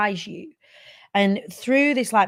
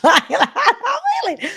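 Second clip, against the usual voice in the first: slow, giggling through the words; laughing; 6 dB louder, slower than usual. Real, not acted. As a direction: let the laugh carry it up high.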